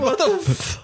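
high, cracking with laughter; laughing disbelief. Retold or imitated. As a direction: blurt it out mid-laugh. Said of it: "And you're like".

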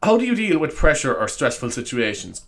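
ordinary delivery

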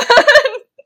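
loud high cackle; high squealing laugh. Real, not acted. Laughing burst.

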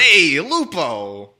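loud, slow bully call. Retold or imitated. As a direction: drawl the name like a bully. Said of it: "the main bully's name is Lupo".

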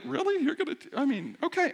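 high, incredulous mock disbelief, then grudging okay; wife's skeptical look. Wife doubting him over the caramel donut, high incredulous mock. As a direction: play the wife raising an eyebrow at him taking her donut. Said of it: "the look on her face, which is like".

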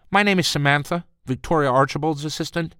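high, tight secretary voice; a crisp, pinched receptionist; prim assistant voice. Acted.

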